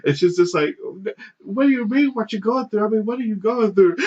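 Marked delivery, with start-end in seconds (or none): 1.4-4.0 high, squeaky mock woman's voice; high mock girlfriend. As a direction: mimic her pleading in a high squeak. Girlfriend voiced, much higher.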